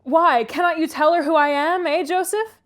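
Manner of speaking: high, loud appeal; calling up. A narrator's reading. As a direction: call out to the servant at the window. Visitor calls up to servant.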